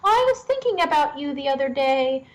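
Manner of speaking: high-pitched grandmother voice; imitating a grandmother. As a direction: play a grandmother offering advice in a high lilt. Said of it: "his grandmother was like".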